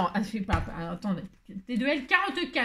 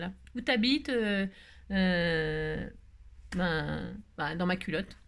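usual speaking voice

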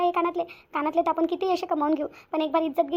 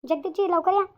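urgent calling out; 3 semitones higher, 3 dB louder, slower than usual. Acted. Wife calling her husband urgently from inside. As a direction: shout for him to come quickly.

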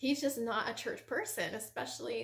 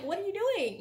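higher, louder mock-disbelieving question; incredulous; 9 semitones higher, 5 dB louder, faster than usual. Retelling disbelief at her sister going to church, incredulous. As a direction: pitch it up like you can't believe her.

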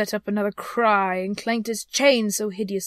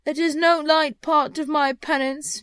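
slow, raised, loud phantom voice; solemn ghost; 7 semitones higher, 6 dB louder than usual. Acted. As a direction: intone it as a spirit doing penance.

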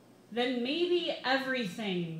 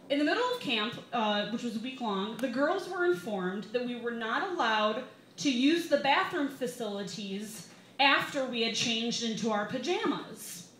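usual speaking voice

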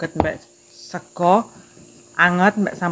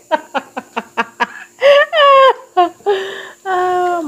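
high, helpless burst of laughter; loud laughter; 13 semitones higher, 3 dB louder than usual. Real, not acted. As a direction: laugh until it almost turns to crying. Breaks into laughter.

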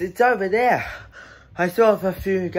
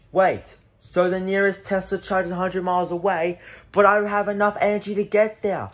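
normal talking voice

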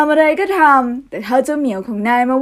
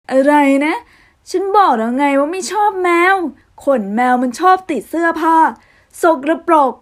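loud, raised, exasperated objection; angry protest; 4 semitones higher, 4 dB louder than usual. Acted. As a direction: blow up at your brother over the cat. Hayato objecting to keeping cat.

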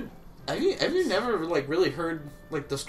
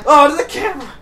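high, loud alarmed cry; real startled shout; startled yelp; 12 semitones higher, 15 dB louder, faster than usual. Real, not acted. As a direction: yelp as the gear gets knocked. Sudden alarm at a mishap.

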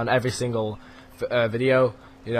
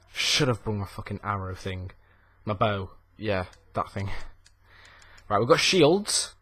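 usual speaking voice

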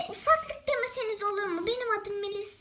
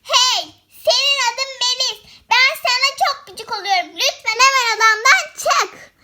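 loud, angry yelling at the guest; angry shouting; 5 semitones higher, 8 dB louder than usual. Pretend. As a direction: kick her out of the room with a yell. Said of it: "sen kendin daha çok bağırıyorsun".

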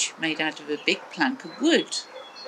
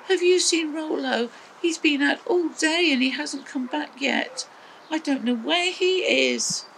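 Maggie asking; 9 semitones higher than usual. A narrator's reading. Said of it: "Maggie said".

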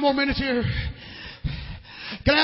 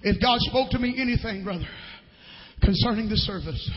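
ordinary delivery

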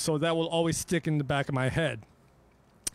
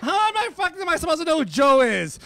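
exasperated rant; 9 semitones higher, 6 dB louder, faster than usual. Real, not acted. Ranting about fan names.

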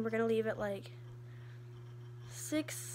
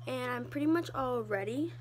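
normal talking voice

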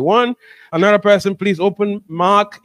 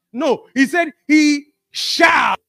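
emphatic preaching shout; 6 semitones higher, slower than usual. Real, not acted. Preacher insists on shall.

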